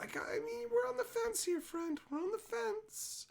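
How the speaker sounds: high, soft mock-prim voice. Real, not acted. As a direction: squeak it out primly, half joking. Joking disapproval of Santa.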